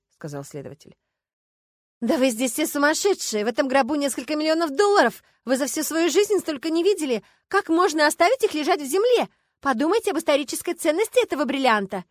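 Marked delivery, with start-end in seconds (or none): 2.0-12.0 high, loud, hysterical tirade; frenzied outrage. A narrator's reading. Starkova berating everyone over millions left in earth, frenzied. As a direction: rail at all of them, pitched right up. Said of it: "посмотрела на присутствующих безумными глазами".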